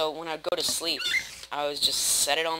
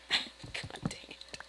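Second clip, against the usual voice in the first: embarrassed whisper; 9 dB quieter, much slower than usual. Real, not acted. Embarrassed after overshare.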